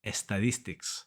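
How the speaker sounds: estadístics with an extra E in front and a D; Spanish-speaker mispronunciation. Shown as a demonstration. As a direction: say "es-ta-DEES-tics", the learner's mistake. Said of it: "say estadístics".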